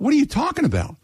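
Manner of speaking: high-pitched retold incredulity; exasperated disbelief. Retold quarrel with phone agent.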